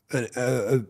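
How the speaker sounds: usual speaking voice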